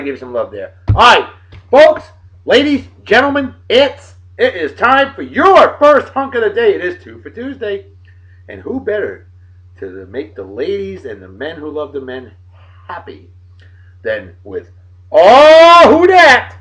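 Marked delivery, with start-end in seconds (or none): 0.9-6.7 high, loud showman announcement; announcer hype. Acted. Host to viewers, announcing the hunk of the day in a loud high hype. 15.1-16.6 high loud mock gasp of surprise. Host to viewers, revealing Sam Page, loud mock surprise. Reacting to the hunk picture.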